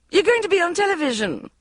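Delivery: high, loud mother gush; thrilled and overflowing, very high. A narrator's reading. Mother announcing Bridget's TV spot, high gushing. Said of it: "she gushed".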